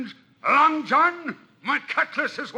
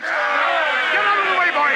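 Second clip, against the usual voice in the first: shouting; 4 semitones higher, 6 dB louder, slower than usual. Acted. Warning Jim before fighting.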